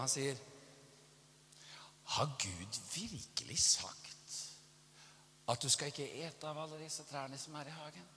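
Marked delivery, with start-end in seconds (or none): 2.2-8.1 devil tempting. Retold or imitated. Devil speaking to Eve in the garden, sly tempting. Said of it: "Hva er det han sier for noe til Eva?".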